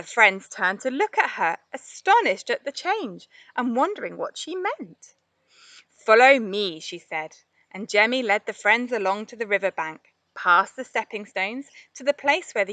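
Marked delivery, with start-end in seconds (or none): 6.0-6.8 louder call to follow; leading call. A narrator's reading. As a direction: lead them off with a call. Jemmy leads the friends.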